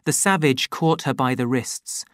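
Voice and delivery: usual speaking voice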